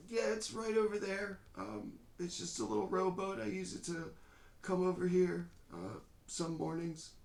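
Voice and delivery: put-on high, halting frail old man. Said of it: "this kind of frail voice".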